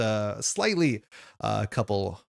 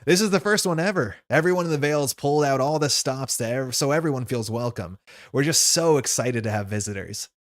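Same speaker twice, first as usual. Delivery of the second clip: put-on keen young host, higher at first; eager; 3 semitones higher, 4 dB louder than usual. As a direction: gush a welcome to visitors.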